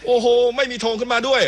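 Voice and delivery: high-pitched live football call; excited play-by-play. Real, not acted. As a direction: pitch jumps right up for the goalmouth chance. Live goalmouth action.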